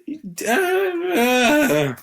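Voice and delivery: high, drawn-out strangled groan; hammed-up wordless failed impression; garbled voice attempt. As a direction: squeal out a botched accent attempt.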